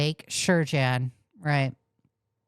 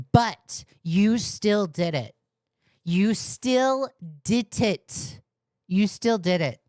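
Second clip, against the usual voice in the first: slow, pitched up, stress on still; hammering retort; 4 semitones higher, slower than usual. Real, not acted. Streamer scolding apologizers, hammering each word. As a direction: drive the point home each time.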